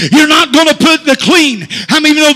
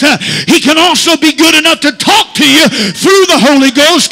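ordinary talk

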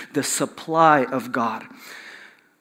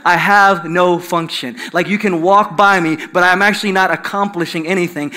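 ordinary talk

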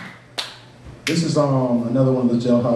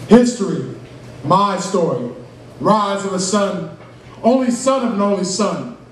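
higher, drawn-out spoken-word delivery; spoken-word poem; 4 semitones higher, slower than usual. Acted. Poet reciting own piece to open-mic crowd, spoken-word style. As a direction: recite it like a slam poem, lifting each phrase.

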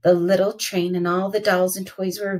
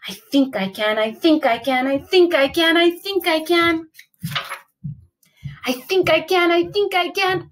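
building effort; 6 semitones higher than usual. A narrator's reading. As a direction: chant it as the climb gets harder. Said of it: "She tugged and pulled".

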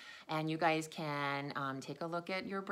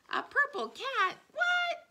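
much higher, louder playful disbelief; mock surprise; 9 dB louder, slower than usual. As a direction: gasp in fun at a purple cat. Mock surprise at purple cat.

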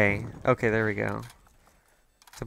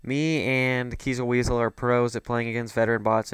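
sarcastic; 7 dB quieter than usual. Acted. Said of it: "I'm being sarcastic".

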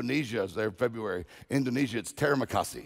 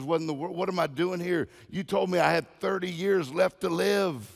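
higher-pitched, mildly indignant protest; indignant complaint; 6 semitones higher than usual. Acted. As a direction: protest in disbelief, pitch lifted. Woman complains to God in joke.

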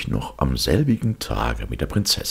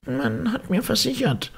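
much higher, sly cat voice. Acted. Cat flatters the ogre in a much higher voice. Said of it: "sagte der Kater".

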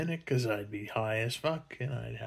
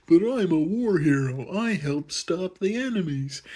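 hurt mock hero, higher and louder; mock protest; 6 semitones higher, 5 dB louder than usual. A war hero protesting to the admiral, mock indignant. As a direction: plead your case, indignant. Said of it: "He's like".

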